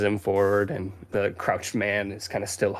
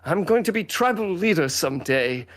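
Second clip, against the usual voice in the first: put-on higher, protesting young boy; defiant Fifi; 9 semitones higher than usual. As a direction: insist you can look after yourself, in a boyish character voice. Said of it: "he's like".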